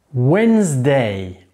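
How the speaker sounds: slow "Wednesday" as WENZ-day, silent first d; teacher's careful "Wednesday", said WENZ-day; model pronunciation. Shown as a demonstration. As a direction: say "Wednesday" in two drawn-out syllables, WENZ-day. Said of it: "Écoutez bien la prononciation".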